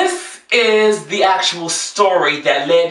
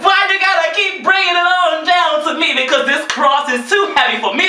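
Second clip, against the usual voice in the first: mock gospel lament; 10 semitones higher than usual. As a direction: cry out to God like a hammed-up preacher.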